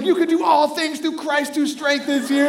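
high, loud rallying cry; inspiring pep talk. Retold or imitated. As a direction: preach it like a frantic motivator. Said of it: "I started inspiring him".

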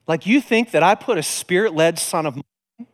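ordinary talk, nothing marked

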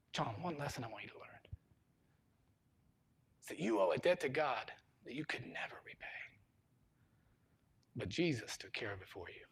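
hushed, near-whispered fatherly counsel; tender father. Acted. Dad teaching his crying son one lesson, tenderly hushed. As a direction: speak softly and gently, with long pauses.

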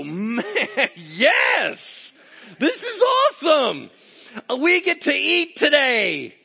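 high, loud shouts of joy; hammed-up jubilant yelling; exuberant. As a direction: whoop it out like a worker paid a windfall. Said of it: "they're just exuberant".

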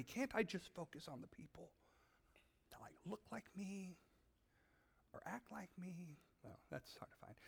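hushed whisper of a selfish thought; whispered inner excuse. Acted. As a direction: breathe it like a guilty secret.

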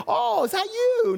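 put-on light up as if meeting a dear old classmate; delighted surprise. Shown as a demonstration. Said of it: "you hope you get".

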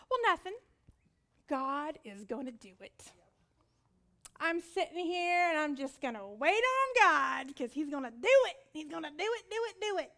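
a touch of smug, sing-song waiting on God; mocking complacent believer. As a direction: parody the lazy believer lightly.

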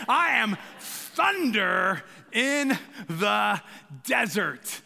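slow drawn-out ring-announcer boom; hammed-up ring announcer; wrestler announcer. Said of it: "like a wrestler's name".

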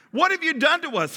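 demanding. Acted. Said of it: "he demanded".

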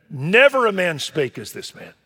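NEVER hit hard, loud and high; awed loud proclamation. Acted. Soldiers report to the priests, loud and awed.

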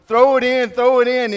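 frustrated coach jumping up and screaming; coach yelling angrily. Retold or imitated. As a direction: bellow it, losing patience. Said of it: "and he's yelling".

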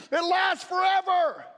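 slow, loud, high shout; preaching shout. Real, not acted. Preacher to congregation, slow ringing shout. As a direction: belt it out slowly to the crowd.

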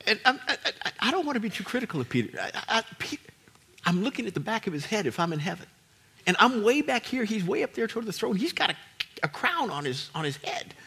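ordinary talk

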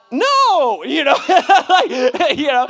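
loud, high, drawn-out protest; mock yelled refusal. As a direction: cry out a comic refusal. Retold refusal to God.

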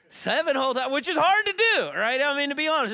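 loud, very high-pitched outburst; high, impressed holler; amazed exclamation. Acted. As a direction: shout it in hammed-up amazement. Exclaims how hard seven times is.

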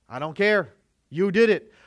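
loud, harsh scolding yell; angry parent. Acted. Parent shouting down a child's side of the story. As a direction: bark at the child, won't hear it.

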